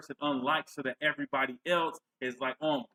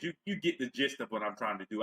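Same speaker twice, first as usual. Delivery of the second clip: ordinary delivery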